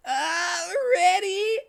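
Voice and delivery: high, loud cracking squeak; yelling Tails. Acted. Tails yelling to Cream, squeaky shout. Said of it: "yelled Tails to Cream".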